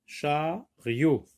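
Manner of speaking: "Chariot" as two beats, cha, riot; split into syllables. Shown as a demonstration. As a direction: pause inside "Chariot" after cha. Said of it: "Deux syllabes".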